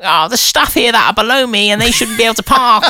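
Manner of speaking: teasing impression of a haughty moaner; mock frustrated moaning. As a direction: imitate a self-important colleague grumbling. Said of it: "That's not moody, it's frustration".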